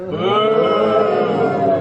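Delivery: loud crowd boo. Acted. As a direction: boo the villain loudly. Booing villain.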